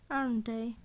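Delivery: ordinary delivery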